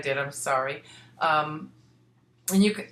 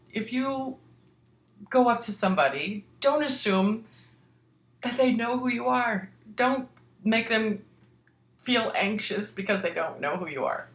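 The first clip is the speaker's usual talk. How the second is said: higher, preachy scolding; mock lecturing; 6 semitones higher than usual. Real, not acted. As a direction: wag a finger at the listeners. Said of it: "Just saying".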